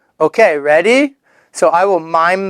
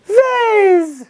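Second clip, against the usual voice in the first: put-on high child reply; 14 semitones higher than usual. High voice of a learner.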